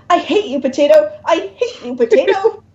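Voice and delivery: a tantrum at a potato; mild yelling. Acted. Demon stomps the potato, mild high mock yell.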